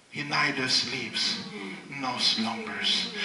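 quiet and slow; hushed slow scripture; soft slow emphasis. Real, not acted.

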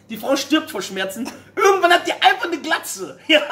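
ordinary talk

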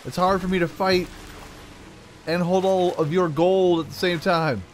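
high, loud, fake-polite excuses; sing-song sorry, I'm busy; mock apologetic. Player taunting chasing skeletons, high and fake-sorry.

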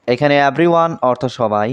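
usual speaking voice

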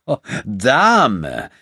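scornful repetition of Dame; sneering. A narrator's reading. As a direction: sneer the word Dame back at him. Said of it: "höhnte der Fremde".

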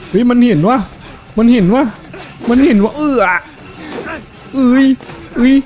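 panicked repeated warning; alarmed. Real, not acted. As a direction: react as if spotted. Spotted by monster, alarm.

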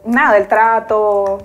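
normal talking voice

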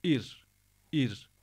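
"ir" with a light front rolled R; thin R. Shown as a demonstration. Teacher showing ra after kasra, thin "ir". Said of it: "harekesi esre ise".

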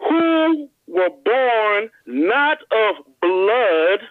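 very slow, each word drawn out; slow deliberate scripture reading. Shown as a demonstration. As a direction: read it slowly so every word lands. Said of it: "I'm gonna read it slow".

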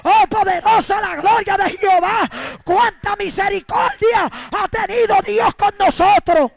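loud, slow, high preaching shout; shouted praise. Acted. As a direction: cry out God's mercy to the congregation. Preaching at full force.

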